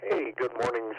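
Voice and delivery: ordinary delivery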